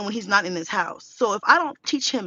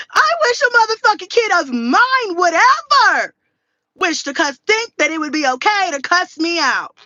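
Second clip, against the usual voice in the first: high, loud hammed-up outrage; outraged warning; 9 semitones higher, 8 dB louder than usual. Daughter tells her mom nobody cusses her out, hammed-up outrage. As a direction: belt it out as a mock threat.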